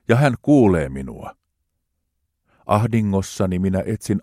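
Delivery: hartaasti, rauhallisesti luettu psalmi; juhlallinen psalmin luenta. Acted. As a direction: lue kuin rukousta jumalanpalveluksessa. Reads psalm aloud solemnly. (juhlallinen raamatunluku)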